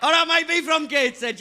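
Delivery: high, loud singsong Welsh-Indian-Geordie accent; exaggerated drawn-out Welsh-Indian accent; Geordie-Welsh-Indian accent demo. Shown as a demonstration. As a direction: stretch the key words in an interchangeable Welsh, Indian or Geordie lilt. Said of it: "are perfectly interchangeable".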